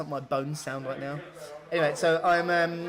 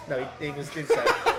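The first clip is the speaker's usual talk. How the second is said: ordinary talk, nothing marked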